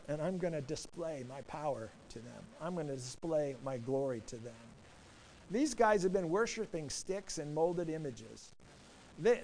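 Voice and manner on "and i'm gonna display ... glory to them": low, soft voice of God; hushed divine declaration. Retold or imitated. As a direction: lower and soften it for God's solemn promise. Voicing God's promise to Moses.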